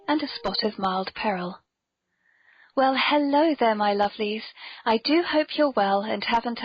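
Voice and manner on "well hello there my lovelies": usual speaking voice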